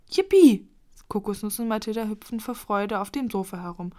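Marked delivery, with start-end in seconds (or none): 0.1-0.7 high, loud squeal of joy; jubilant, shouted hooray; joyful cheer. A narrator's reading. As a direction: cheer it out at full pitch. Children jump for joy in story.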